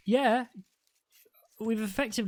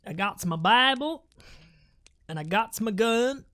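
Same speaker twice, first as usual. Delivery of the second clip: put-on Southern American accent; 3 semitones higher, 4 dB louder than usual. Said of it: "more like southern, s-, southern state Americans".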